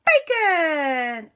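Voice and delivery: squeaky high shout at the basket; high excited call. Acted. A fairy shouting to the magic basket for bacon. Character calls to picnic basket.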